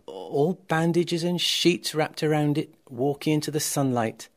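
ordinary delivery

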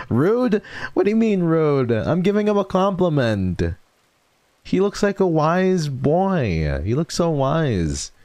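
high, indignant protest; mock offended. Real, not acted. Streamer protesting to chat who called it rude, mock offended. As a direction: play it mock-offended, defending him.

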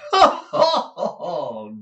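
loud, high acted ha-ha-ha; theatrical laugh. Husband gloating over jealous countesses, loud affected laugh. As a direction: burst out high, then tumble down. Mantalini laughs at his own picture.

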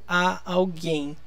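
a held long before alguém; slow "a alguém", the a set apart and drawn out; stress on A. Shown as a demonstration. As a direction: lean on the preposition a in "a alguém". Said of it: "a gente fala a".

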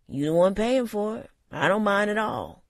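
teasing gruff barman. A narrator's reading. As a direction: rib him like a bartender. Sam teasing.

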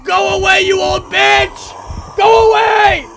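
high, loud, drawn-out yell; furious shouting. Real, not acted. Gamer to Granny attacking him, furious shouting.

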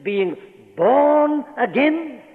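BORN high, loud and drawn right out; slow, ringing preacher's stress on "born"; emphatic scripture repeat. Real, not acted. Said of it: "That's why I'm emphasizing verse".

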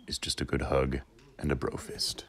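soft, hushed whisper. Pretend. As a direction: whisper the punchline. Whispered punchline.

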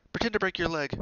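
high, loud bright-idea voice; urgent piece of advice; eager suggestion. A narrator's reading. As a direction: pitch it up as a quick suggestion. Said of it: "Hermione suggested".

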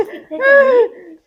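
slow, loud, laughing and wailing at once; ਕਿੱਥੇ ਚੱਲੀ stretched slow with a cackling wail; eerie ghost taunt. Acted. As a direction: drag it out like a haunting spirit. Possessed daughter-in-law taunts mother.

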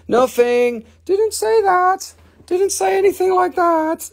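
high innocent sing-song protest; mock innocent denial. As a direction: squeak out a fake innocent cover-up. High denial after slip.

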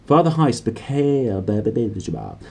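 ordinary delivery, nothing marked

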